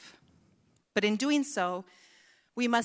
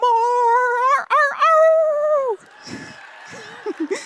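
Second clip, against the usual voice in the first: much higher, louder howl; dog howl; 13 semitones higher, 7 dB louder, much slower than usual. Retold or imitated. Speaker ends the dog song with puppy howling. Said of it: "howl the blues".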